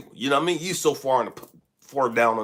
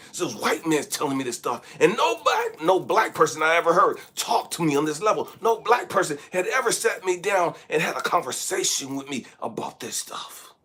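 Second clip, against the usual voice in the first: ordinary talk, nothing marked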